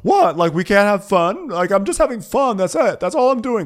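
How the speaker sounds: high-pitched put-on indignation; mock defensive protest. Girlfriend in a phone fight, mock defensive. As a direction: act out wide-eyed innocence, high and insistent.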